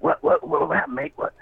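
imitating a panicked buddy, voice jumping up; panicking Luke. Teller imitating panicked Luke hearing of the broken leg. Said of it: "Luke's panicking".

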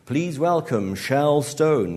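loud, drawn-out stage announcer; MC introduction. A narrator's reading. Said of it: "the M C said".

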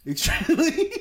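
high burst of laughing speech; loud, amused squeak. Real, not acted. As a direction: laugh right through the word. Amused at his own aside.

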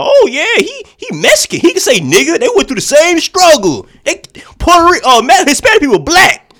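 very high, loud hammed-up cheer; mock cheering crowd. Imitating people cheering [name] on, mock cheering. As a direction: mockingly whoop it up in a squealing high voice. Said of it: "was cheering him on".

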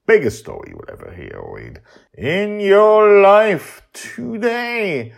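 loud, high showman hype; over-the-top trailer voice; hyped announcement. Pretend. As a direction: sell it like a carnival barker. Hyping the episode.

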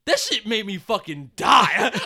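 ordinary delivery

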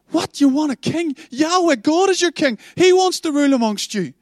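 high, astonished protest; can't believe what you're hearing; shocked disbelief. Acted. Samuel answering the people asking for a king, high and astonished.

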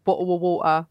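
hammed-up British catchphrase accent; mock British cliché accent. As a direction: parody the stock British accent, swallowing the t's. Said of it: "I'm really impressed that you didn't go".

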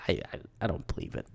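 fast, very soft, stammered aside; hushed, hurried disbelief; muttered disbelief. Real, not acted. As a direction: trail into a low, quick mutter of disbelief. Disbelief at the character.

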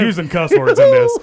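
breaking into laughter mid-sentence, pitch jumping up; giggling through the punchline; laughing. Real, not acted. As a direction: laugh the words out, barely holding it together. Laughing while retelling bar story.

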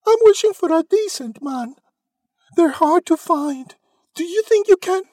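put-on very high, loud girlish voice; Cindy's answer. A narrator's reading. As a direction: plead your wish in a high, eager voice. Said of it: "Cindy answered".

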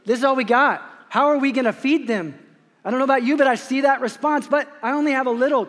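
flustered disciples with a nervous laugh; panicked disciples. Retold or imitated. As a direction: plead helplessly, we don't have enough. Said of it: "and they say".

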